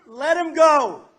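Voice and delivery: loud, slow, forceful command; shouted demand. Retold or imitated. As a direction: bellow the order at Iran. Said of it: "But I'd say to the Iranians".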